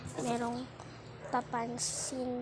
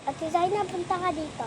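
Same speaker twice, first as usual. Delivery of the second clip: calling out; 5 semitones higher, 5 dB louder than usual. Real, not acted. Calling someone over.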